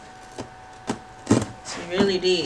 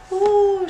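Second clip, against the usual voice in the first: excited squeal; 13 semitones higher, 7 dB louder than usual. Real, not acted. Squeal at first item.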